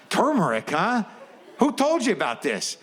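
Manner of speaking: high suspicious probing; sniffing out the source. Retold or imitated.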